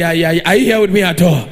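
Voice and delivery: ordinary delivery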